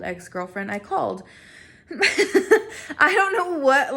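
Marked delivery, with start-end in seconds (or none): 1.9-3.9 slow, high, laughing through the words; giggling at her own shameful move; embarrassed laugh. Real, not acted. Admitting to viewers she called the girlfriend, laughing.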